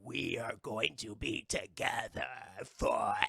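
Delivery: mock-sinister monster declaration; monster Cherry. Voices the monstrous chair.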